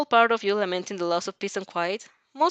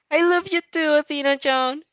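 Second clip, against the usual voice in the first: high, loud and gushing with affection; 7 semitones higher, 4 dB louder than usual. A narrator's reading. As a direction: pour out delight to your best friend. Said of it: "she gushes".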